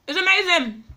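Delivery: high, loud burst of delight over the food; delighted exclaim. Real, not acted. Vlogger raving to viewers about his food, high loud delight. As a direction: cry out how good it tastes.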